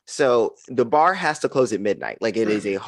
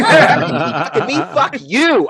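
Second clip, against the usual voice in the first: much higher, louder, laughing brassy exit line; wild saloon girl; 13 semitones higher, 6 dB louder, much slower than usual. Retold or imitated. Comic playing herself exiting the bar as a saloon girl, high, loud and laughing. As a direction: belt it out like a rowdy saloon girl storming off. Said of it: "like a wild saloon girl".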